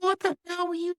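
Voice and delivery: very high strained mock coach voice; angry coach. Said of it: "Coach Fry's like".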